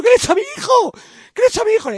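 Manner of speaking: high, loud, hammed-up weeping wail; mock sobbing Marichalar. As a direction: wail it like a desperate father. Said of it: "Y llorando, diciendo".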